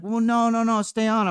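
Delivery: high, loud pleading chat voice; mock chat pleading. Streamer imitating chat begging him to stay on, high and pleading. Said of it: "stream's like".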